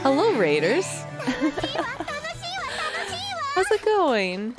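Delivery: high cutesy greeting with giggle; bubbly anime girl hello; cute anime streamer. Acted.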